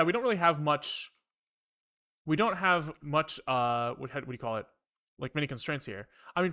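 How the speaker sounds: ordinary talk, nothing marked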